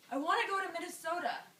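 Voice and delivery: acted desperate wail for home; desperate plea. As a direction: cry out your wish, pitch jumping up.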